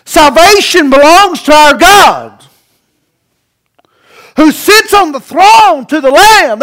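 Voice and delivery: loud, slow, ringing cry of praise; slow, loud shout of worship. Pretend. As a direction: call it out to the throne. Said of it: "they're doing it with a loud voice".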